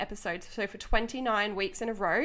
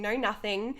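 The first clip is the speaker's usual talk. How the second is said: normal talking voice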